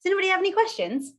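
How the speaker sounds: high, quick cheery ask; bright hostess asking the room; sing-song host voice. Shown as a demonstration. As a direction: chirp the question brightly up high. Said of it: "here is how you communicate with me in a setting like this".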